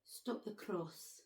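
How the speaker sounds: slow careful try at Scottish Stop the cross; attempted Scottish accent. Shown as a demonstration. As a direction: copy the Scottish phrase slowly. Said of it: "That was good!".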